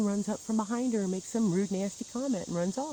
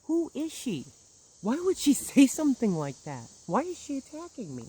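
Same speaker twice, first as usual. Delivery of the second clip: pitched up, pleading and bewildered; mildly frantic retold questions; upset questioning; 6 semitones higher, 5 dB louder than usual. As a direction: retell asking in distress, voice raised high. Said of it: "I'm like".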